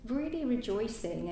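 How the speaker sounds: usual speaking voice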